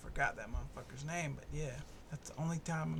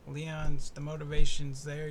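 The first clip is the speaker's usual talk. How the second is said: emphatic; 11 dB louder than usual. Real, not acted. Loud emphatic point.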